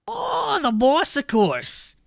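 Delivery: bored toad grumbling about his job; toad folk accent. Acted.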